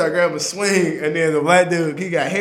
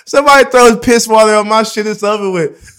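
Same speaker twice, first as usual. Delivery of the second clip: loud mock warning, higher at first; retold angry threat; 5 semitones higher, 9 dB louder than usual. Man retelling his threat to cellmates, mock angry. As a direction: start loud and high, easing off by the end.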